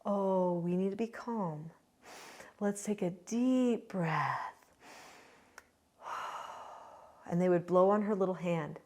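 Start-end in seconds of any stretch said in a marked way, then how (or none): 0.1-4.5 slow, soft, soothing parent voice; calm parent. Retold or imitated. Parents coaching a four-month-old, calm. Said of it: "started talking to her about calmness". 6.1-6.6 soft blown-out breath; slow deep exhale. Retold or imitated. Parents blowing on baby's little hand, calm exhale.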